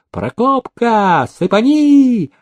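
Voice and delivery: very high, drawn-out cry; gleeful high-pitched whoop of command; exultant. A narrator's reading. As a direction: cheer him on, stretching the calls. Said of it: "Никита Никитич ликовал".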